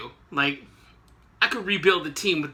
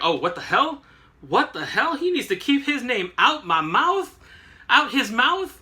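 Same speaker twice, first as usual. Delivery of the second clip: hammed-up offended splutter; indignant outburst; 6 semitones higher than usual. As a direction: hear your name and blow up. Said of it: "That, that was better".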